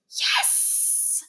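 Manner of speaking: stage whisper, triumphant; loud whisper. Acted. Niles celebrates getting his diorama approved, stage whisper. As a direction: whisper it, half to yourself, pleased. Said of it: "Niles whispered loudly".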